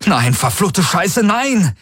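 high, drawn-out cursing outcry; desperate cry. A narrator's reading. Garland curses as the fleets open fire, desperate cry. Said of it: "rief er".

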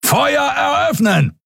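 very high, drawn-out battle order; shouted command. A narrator's reading. Captain orders his crew to fire, shouted command. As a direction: cry out the order to the gunners.

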